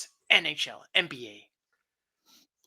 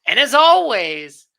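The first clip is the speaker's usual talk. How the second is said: big sign-off; 5 semitones higher, 10 dB louder, slower than usual. Acted. Drawn-out podcast sign-off.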